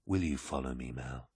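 low, hushed, intimate question; quiet appeal. A narrator's reading. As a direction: ask softly for loyalty. Horus gently asks aide's loyalty.

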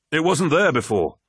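protesting officer. Acted. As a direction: push back, a little indignant. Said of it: "protested the officer".

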